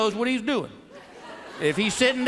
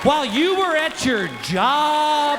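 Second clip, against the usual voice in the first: much higher, shrill-pitched mock yell; mock wife scream; 7 semitones higher than usual. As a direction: burst out like a fed-up wife. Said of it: "Your wife screams".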